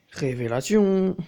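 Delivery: slow, loud, drawn-out "Révélation"; mock dramatic. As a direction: announce it like a hammed-up grand reveal. Repeats the word theatrically. (annonce faussement théâtrale, étirée et forte)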